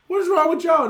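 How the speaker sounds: loud, high rebuke of the panicking disciples; half-laughing shout of what is wrong; Jesus shouting. Retold or imitated. Jesus to his panicking disciples: loud, high exasperated shout. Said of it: "Jesus comes up there and said".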